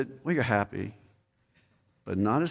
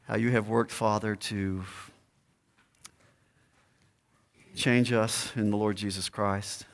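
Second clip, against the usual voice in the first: pastoral prayer; 3 semitones lower than usual. Acted. Closing prayer.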